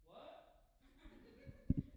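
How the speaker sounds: high whispered what; feigned shock. Retold or imitated. Believer dodging the command, high breathy what. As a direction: act like you misheard.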